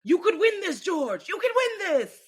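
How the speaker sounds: high, loud mock yell; imitating screaming boss. Mocking Toto yelling over George's radio, high mock scream. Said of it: "why the fuck are you screaming in my ear".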